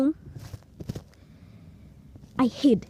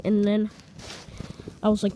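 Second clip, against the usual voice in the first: ordinary delivery, nothing marked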